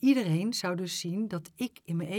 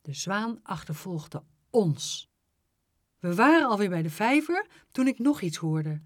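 plain reading voice

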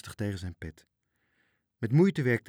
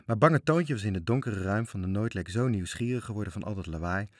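usual speaking voice

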